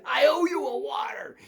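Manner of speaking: high shouted call; shouting across the lunchroom at the big guy. Pretend. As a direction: shout it out proudly. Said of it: "and you".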